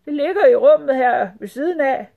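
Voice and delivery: high, loud nervous rat voice; nervous stammering. A narrator's reading. Rat telling sultan where the carpet is, nervous. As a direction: answer anxiously, pitched high. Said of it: "stammede rotten nervøs".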